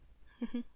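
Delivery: light breathy chuckle; a quick warm laugh. Acted. Laugh in tender roleplay.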